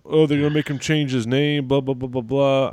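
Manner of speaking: high, loud mocking whine; mocking whiny complainers. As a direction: sneer at the Twitter complainers. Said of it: "people are complaining on Twitter".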